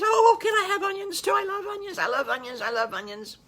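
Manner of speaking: put-on a thrilled boy who loves onions; excited little wolf.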